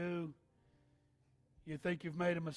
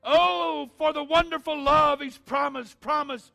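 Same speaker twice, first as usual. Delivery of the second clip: building to loud, higher, fervent preaching; preaching at full force; 7 semitones higher, 15 dB louder than usual. Acted. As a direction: rise into a roaring altar call. Preacher building altar call.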